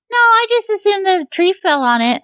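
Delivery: high-voiced little fella; squeaky-high quoted workman. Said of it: "a higher pitched voice than you".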